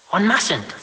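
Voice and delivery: high prim mother hushing her son; prim reproof. Acted. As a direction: scold gently in a high prim voice.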